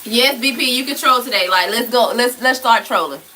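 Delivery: loud, eager encouragement; hyped up. Real, not acted. Telling viewer he can troll, loud and hyped. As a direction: pump the viewer up loudly. Excited chat permission to troll.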